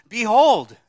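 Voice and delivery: cried out loud and high, Behold; loud proclamation. Shown as a demonstration. Preacher quoting Matthew to congregation, loud proclaimed Behold. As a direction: ring out Behold like a herald. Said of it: "Matthew says".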